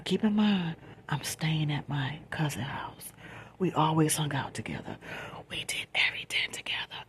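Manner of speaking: soft late-night whisper; quiet at night. Real, not acted. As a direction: whisper so nobody wakes up. Late-night storytime, hushed.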